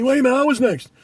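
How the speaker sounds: high, loud mock protest; taken aback. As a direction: object like a customer cut in line. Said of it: "they're taken aback".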